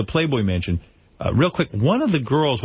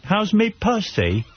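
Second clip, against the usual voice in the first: mock Australian accent; 6 semitones higher, slower than usual. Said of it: "That's my impression of her".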